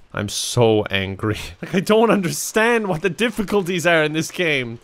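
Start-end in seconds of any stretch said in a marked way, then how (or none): 0.1-1.4 slower, drawn-out I'm so angry; mock-sulky drawn out; slow mock anger. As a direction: stretch out the fake anger.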